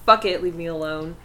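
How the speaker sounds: normal talking voice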